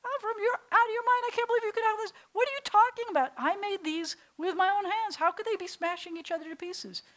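high, loud exasperated dad; a father yelling at his son. Acted. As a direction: shout him down in disbelief. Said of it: "starts yelling at him".

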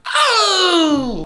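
sudden high shout of pain; real yelp from slapping a ring. Real, not acted. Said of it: "that was an interesting sound I just made".

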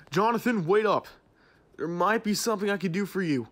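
loud call to stop a leaving friend; calling after him. Acted. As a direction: shout after him as he walks off. Calling after departing employee.

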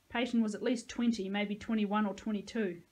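plain reading voice

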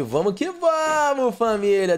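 high, slow hyped-up shout; rally the chat, stretched out; hype shout. Real, not acted.

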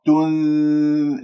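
"تن" held long through the nose; hidden nun. Shown as a demonstration. Teacher shows where the tongue goes, held nasal تن. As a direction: hum the ن with tongue near the ذ. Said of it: "لاحظ اللسان وين راح".

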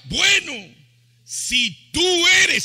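high, loud, emphatic reply; decided man answering. Retold or imitated. Peter answers the Lord's call, loud and decided. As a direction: stretch it out boldly at high pitch. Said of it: "¿Sabe qué dijo este hombre?".

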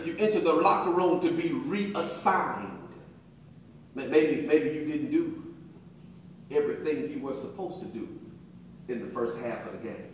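usual speaking voice

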